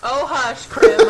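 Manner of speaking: playful, loud "hush"; sassy retort. Real, not acted. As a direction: snap back with mock offence. Teasing retort to a gaming friend.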